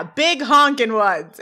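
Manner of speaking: loud, drawn-out brash answer; brash boast. Retold or imitated. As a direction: retell it big and slow, stretching the words. Sean's brash answer, later repeated.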